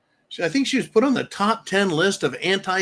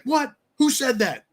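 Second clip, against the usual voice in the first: mock outrage; 10 semitones higher than usual. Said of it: "people are like".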